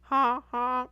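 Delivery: high, loud gloating taunt; mocking taunt. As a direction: jeer at him, loud and high. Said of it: "I would have got out and said".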